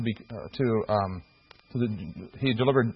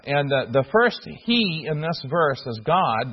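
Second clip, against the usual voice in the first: ordinary talk, nothing marked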